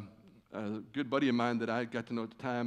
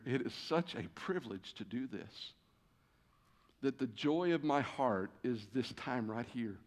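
softer, heartfelt quiet words; tender, sincere; 2 semitones lower, 6 dB quieter than usual. Real, not acted. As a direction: speak your love for them gently. Tender confession of love for church.

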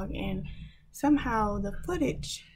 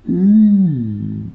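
loud, low "mm" of pain; pained groan; 4 semitones lower, 13 dB louder than usual. Real, not acted. Vlogger just hit themselves mid-sentence, pained groan. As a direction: groan loudly after a sudden knock. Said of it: "I just hit myself".

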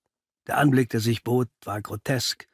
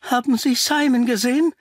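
much higher, anxious question; breathless, agitated woman; 11 semitones higher, 4 dB louder than usual. Acted. Mrs Doyle asks Pickley about Simon, agitated. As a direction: voice an upset woman urgently seeking someone. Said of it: "Die Frau rang nach Luft".

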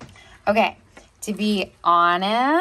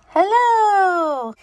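high bright Hello; excited hello; 10 semitones higher, 6 dB louder than usual. Pretend. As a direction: call out hello to him. Greets toy under sheet.

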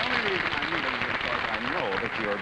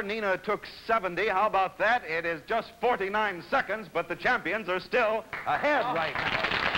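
ordinary talk

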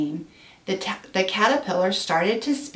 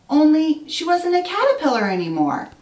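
rising higher and louder to the reveal; building wonder; 10 semitones higher, 4 dB louder than usual. A narrator's reading. Storyteller revealing the butterfly to children, building wonder. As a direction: build to the big surprise.

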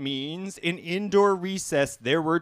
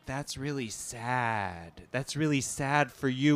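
deadpan sympathy; 6 semitones lower, 7 dB quieter than usual. Acted. Comic answering the niece's guilt trip, deadpan sympathy. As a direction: offer it softly, with no real pity.

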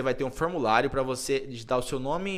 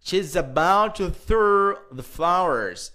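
slow model sentence; 4 semitones higher, 5 dB louder, slower than usual. Shown as a demonstration. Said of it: "teacher models the sentence".

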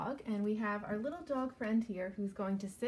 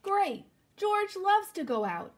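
excited; 8 semitones higher, 7 dB louder, slower than usual. Acted. Dog excited about walk.

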